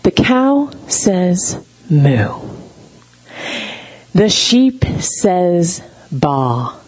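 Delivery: put-on slow, drawn-out toddler story reading; reading a picture book. Shown as a demonstration. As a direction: recite it slowly for a baby. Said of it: "here's how it goes".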